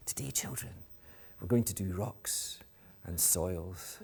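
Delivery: very soft, slow teacher announcement, falling away at the end; underwhelming teacher. Acted. Teacher telling waiting children about rocks and soils, hushed anticlimax. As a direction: quietly let the big project down as a dull classroom topic. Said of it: "when the teacher".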